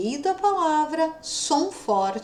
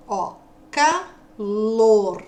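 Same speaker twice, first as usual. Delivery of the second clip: slow clear word; 5 dB louder, much slower than usual. Shown as a demonstration. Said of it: "Eu falei o O e depois o R".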